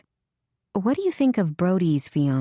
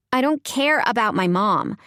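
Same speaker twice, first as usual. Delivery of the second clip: sullen attitude; 5 semitones higher than usual. A narrator's reading. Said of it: "with more attitude than conviction".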